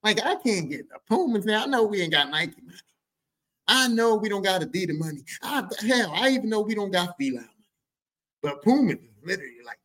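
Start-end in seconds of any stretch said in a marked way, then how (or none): none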